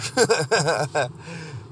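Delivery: amused burst of laughter; laughing. Real, not acted. Podcaster laughing at his own childhood wish to be a pastor.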